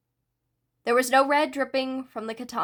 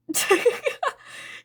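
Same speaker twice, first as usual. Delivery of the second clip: laughing; 11 semitones higher than usual. Acted. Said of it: "Spinner started laughing".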